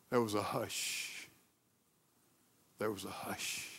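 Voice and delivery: low, very soft, hushed voice; barely above a breath, letting silence in. A narrator's reading. Sombre moment after the story.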